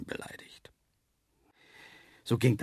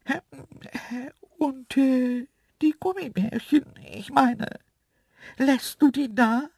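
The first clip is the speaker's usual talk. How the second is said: throat-clearing, high antelope voice; Isabella, hesitant; 5 dB louder than usual. A narrator's reading. Antelope asking for the gummy bears, sheepish. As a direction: ask haltingly in a high, loud voice. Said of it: "räusperte sich Isabella und fragte".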